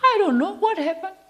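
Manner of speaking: put-on very high little boy voice; small child. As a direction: squeak it out like an innocent toddler. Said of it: "Children, small children".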